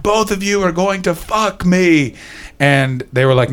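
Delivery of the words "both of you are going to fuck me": mock drunk boast. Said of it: "stood up on the table and went".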